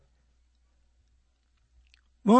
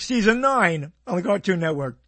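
ordinary talk

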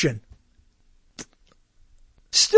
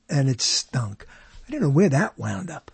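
normal talking voice

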